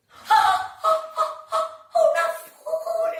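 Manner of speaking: very high, delighted cries; gasping amazement. Acted. Storyteller discovering a flower on the page, squealing amazement. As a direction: gasp and squeal, stretching the last words.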